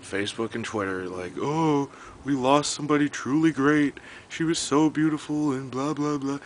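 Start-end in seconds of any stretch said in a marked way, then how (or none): 1.4-6.4 high, gushing fake grief; mock online mourners. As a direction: mimic insincere fans lamenting, pitched up. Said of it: "all these fucking people hopping on".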